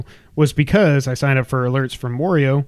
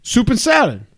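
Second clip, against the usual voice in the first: garbled mock New York "Soup and salad", pitched up; New York accent; 8 semitones higher than usual. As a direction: say "Soup and salad" high, as a New Yorker's soppressata. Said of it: "I thought he was saying soup".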